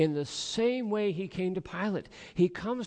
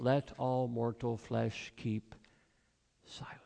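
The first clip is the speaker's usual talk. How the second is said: hushed reading; 6 semitones lower, 5 dB quieter, slower than usual. Retold or imitated. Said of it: "begins by saying".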